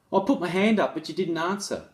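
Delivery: a hint of a cheeky student pleading his case; student making excuses. Zach protesting to his teacher, a lightly voiced mock whine. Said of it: "make excuses for his calling out".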